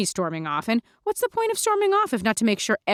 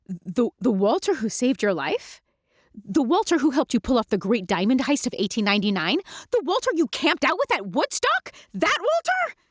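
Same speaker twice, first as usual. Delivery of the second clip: each question climbing higher; mounting disbelief; 5 semitones higher than usual. Retold or imitated. Mandy to Lulu, disbelief rising to a high squeak. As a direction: build incredulity to a squeak.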